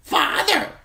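very high, loud bird squawk; taunting old raven. A narrator's reading. Pet raven mocks the young man's hope, high taunting squawk. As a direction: mimic the word like a mocking raven. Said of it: "taunted the old raven".

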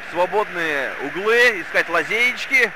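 normal talking voice

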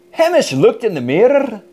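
plain reading voice

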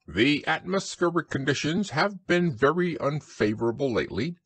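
high, formal owl voice; pompous Owl. A narrator's reading. Owl reporting on the weather to Christopher Robin, pompous.

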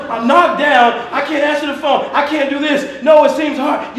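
high, loud complaining coworker. Retold or imitated. Coworker complaining he's knocked down, loud and high. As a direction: play the coworker crying out he's beaten. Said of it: "a colleague saying".

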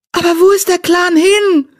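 high wailing question. Acted. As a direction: cry it out in despair at the empty camp. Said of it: "heulte sie".

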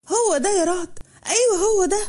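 high, sudden cry of recognition; shouted recognition. Acted. Helm cries out on seeing her attacker, high and startled. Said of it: "صرخت وقالت".

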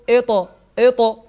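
"إط، إط" with the ط bounced at the end; qalqala bounce. Shown as a demonstration. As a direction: release each ط in "إط" with a short echo. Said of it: "اهتزاز في المخرج".

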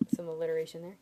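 usual speaking voice

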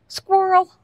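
sudden high, loud cry of squirrel; excited squirrel call. Real, not acted. Calling out to William about a squirrel, high excited shout. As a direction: blurt it out the instant you spot it.

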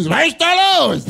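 high startled call from hiding; startled shout. Retold or imitated. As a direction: call out, jolted awake. Startled call from under table.

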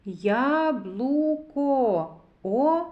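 slow "Яблуко", then a long "О"; sounding out. Shown as a demonstration. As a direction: stretch "Яблуко" and hold the vowel "О" alone. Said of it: "зробимо звуковий аналіз слова яблуко".

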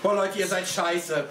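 usual speaking voice

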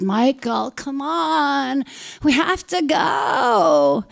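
put-on high, drawn-out drunken-style whine; whining neighbor. Drunk neighbor urging her friend to leave, long whine. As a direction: whine it, stretching the last words.

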